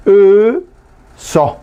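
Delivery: "uso" con la primera vocal guaraní alargada y separada de "so". Shown as a demonstration. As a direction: demuestra "uso" sílaba por sílaba, sosteniendo la vocal inicial. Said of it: "Nosotros aprendemos a pronunciar". (pronunciación didáctica de "uso", vocal inicial sostenida)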